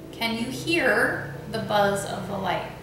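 normal talking voice

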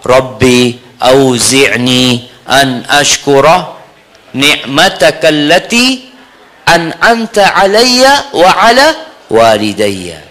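slow chanted supplication, rising then dropping; prayer recitation. Shown as a demonstration. Preacher shows listeners a prayer to read, slow recitation. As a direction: recite in long measured phrases, climbing before the close.

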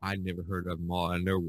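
ordinary talk, nothing marked

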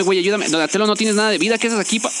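normal talking voice

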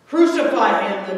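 a mob's screamed demand; crowd scream. A narrator's reading. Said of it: "the crowd screamed".

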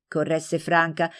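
ordinary delivery

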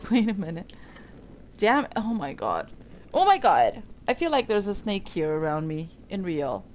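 normal talking voice

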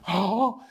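high, loud cry of pain; acted yelp under crushing weight; pained wail. Weight back on, it hurts.

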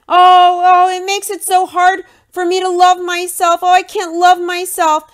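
high, loud whimpering parody of a self-pitying man; mocking whiny Cody. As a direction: whine it like a sulking man-child. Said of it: "Cody can't take a little of the truth".

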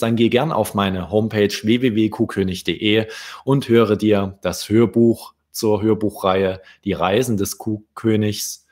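plain reading voice

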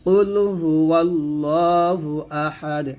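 slow tajweed recitation with "ওয়াল্লাহু" joined; correct recitation. Shown as a demonstration. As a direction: recite it slowly for students to copy. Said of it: "এরকম". (ধীরে তাজবীদসহ তিলাওয়াত)

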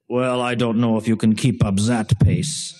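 put-on deliberate German-accented delivery. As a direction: imitate a German academic with a heavy accent. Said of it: "that's my best German, German accent I can do".

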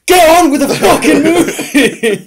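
high, drawn-out yell through a laugh; mock-exasperated holler at a screen; imitated shout. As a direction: yell it full out, imitating a fed-up viewer. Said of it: "literally shouted at the screen".

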